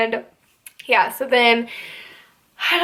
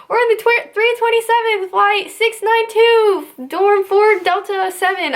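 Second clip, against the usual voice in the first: dazed recruit recitation; 9 semitones higher than usual. Retold or imitated. Recruits reciting long jumble of numbers.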